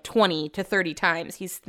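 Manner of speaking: ordinary talk, nothing marked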